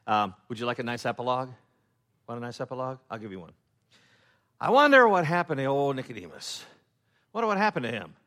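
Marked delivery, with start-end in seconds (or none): 4.6-8.1 loud musing. Acted. Preacher voicing congregation wondering.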